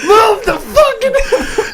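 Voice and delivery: hammed-up shouting at the driver; mock angry yell. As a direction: bark the order while cracking up. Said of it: "Screaming at him".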